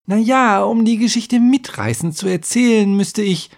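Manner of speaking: high-pitched defensive explanation; earnest scribe. A narrator's reading. Scribe justifies his question to the heroes, earnest explaining. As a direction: explain yourself in a high, put-on voice.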